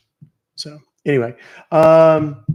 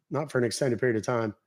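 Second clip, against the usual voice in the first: usual speaking voice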